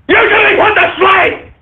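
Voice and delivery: yelling the line he just muttered; angry shout. Real, not acted. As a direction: bellow the verdict. Repeated as a yell.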